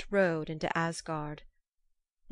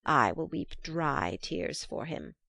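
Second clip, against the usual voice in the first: slow, low, spiteful hag; 3 semitones lower, slower than usual. Acted. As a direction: drag it out like a bitter old witch. Hag mocks the mourning.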